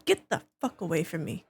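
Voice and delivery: ordinary delivery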